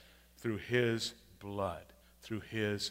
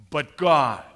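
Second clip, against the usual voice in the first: loud proclamation; 4 semitones higher, 14 dB louder than usual. Acted. Preacher to congregation, loud triumphant refrain. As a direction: ring out BUT GOD, much louder.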